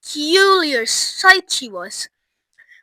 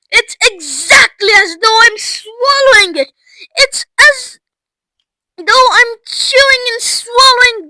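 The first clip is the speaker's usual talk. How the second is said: high, loud rapturous gushing; ecstatic shouting; 11 semitones higher, 11 dB louder than usual. A narrator's reading. Violet chewing blueberry pie gum, shouting in rapture. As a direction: revel loudly in every mouthful. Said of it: "shouted Violet".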